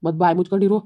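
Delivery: loud, furious command; angry order. Acted. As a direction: shut her up for good. Husband orders wife to shut up.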